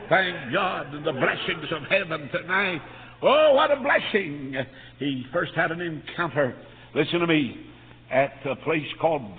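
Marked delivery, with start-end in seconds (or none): 3.2-4.5 high, loud and slow praise; exultant preaching cry. Real, not acted. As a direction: cry it out in thanksgiving. Preacher exulting after the story.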